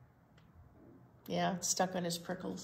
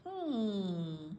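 purring hmm; 4 dB quieter than usual. A narrator's reading. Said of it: "purred the cat".

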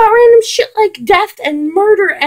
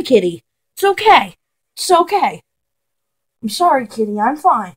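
soothing the cat; 5 semitones lower, 4 dB quieter than usual. Real, not acted. Pausing rant to soothe the cat.

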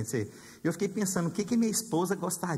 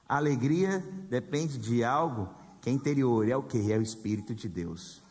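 lower answering contrast; 6 semitones lower than usual. Shown as a demonstration. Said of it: "Entenderam a diferença entre felicidade e alegria?".

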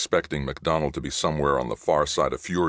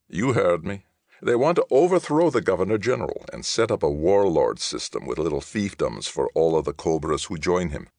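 mild angry outburst; 4 semitones higher, 4 dB louder than usual. Acted. Angry MacDonald, louder.

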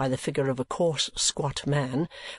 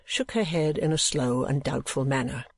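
ordinary delivery

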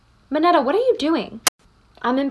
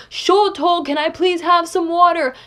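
mock thirsty plea; 5 semitones higher than usual. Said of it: "if he was dying of thirst".

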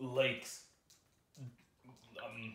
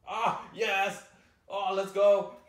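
loud high victory whoop; triumphant cheer; 8 semitones higher, 12 dB louder, much slower than usual. Real, not acted. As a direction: celebrate the win. Success in game.

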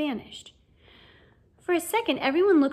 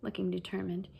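usual speaking voice

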